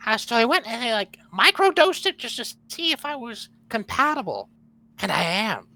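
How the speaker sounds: a hint of a low gremlin croak; lightly voiced little gremlin. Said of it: "Gremlin voice and Mickey Mouse voice".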